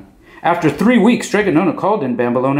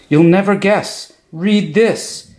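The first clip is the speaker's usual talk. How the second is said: excited; 9 semitones higher, slower than usual. A narrator's reading. Excited news from children.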